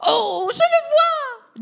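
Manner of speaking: little hero spotting the giant; excited discovery. Acted. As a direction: shout out with wonder. Mickey spots the giant.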